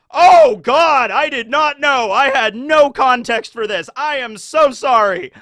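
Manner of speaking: hammed-up embarrassed sorry; mock mortified. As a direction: blurt the shocked apology. Caller realizes the bad news.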